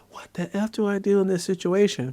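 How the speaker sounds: high, alarmed inner voice; retold inner panic. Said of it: "in my head".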